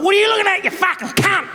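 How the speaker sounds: high, aggressive bogan fish voice; high-pitched mock-aggressive bogan yelling; aggro meth-head fish. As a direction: snarl it like a cranky junkie. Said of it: "Just comes past like".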